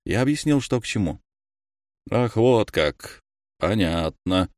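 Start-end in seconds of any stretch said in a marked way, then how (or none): 2.1-4.5 slow, tight, displeased drawl; through clenched teeth. Acted. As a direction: squeeze the words out coldly. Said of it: "процедил завуч". (процедил сквозь зубы, медленно)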